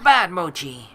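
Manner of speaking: loud sharp scold; scolding the puppy. Acted. Narrator scolds the puppy for biting, loud sharp reprimand. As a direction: bark the reprimand at the dog.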